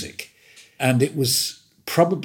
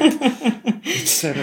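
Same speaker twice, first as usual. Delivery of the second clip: laughing. Real, not acted. Laughing while retelling phone.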